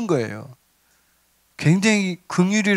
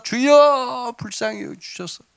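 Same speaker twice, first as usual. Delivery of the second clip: imitated louder cry; 5 semitones higher, slower than usual. Blind men crying out harder to Jesus, imitated drawn-out wail. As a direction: stretch the first word into a pleading call, then drop off. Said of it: "더 소리를 지르면서".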